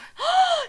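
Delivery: loud, high theatrical gasp; shocked gasp. A narrator's reading. Acted shocked reactions in the story.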